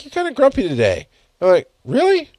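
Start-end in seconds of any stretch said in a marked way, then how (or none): 0.0-1.1 much higher, light teasing voice; imitating wife. Wife telling husband he's grumpy, higher teasing voice. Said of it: "And my wife's like". 1.8-2.3 far higher, genuine-sounding disbelief; a startled, innocent question; surprised. Retold or imitated. Husband surprised when wife calls him grumpy, high and startled. Reacting to wife's remark.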